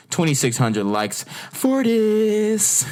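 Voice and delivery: high, slow, stretched-out disbelief; incredulous. Real, not acted. Vlogger reading out a like count, high-pitched incredulity. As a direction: climb high and drag out the last words in disbelief.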